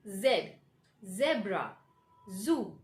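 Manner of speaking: careful isolated words; hard Z. Shown as a demonstration. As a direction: buzz the z in Z, zebra, zoo. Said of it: "take the hard sound".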